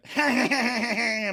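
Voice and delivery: high, hammed-up fake laugh; mock annoying laugh. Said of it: "[name] mocks his annoying laugh".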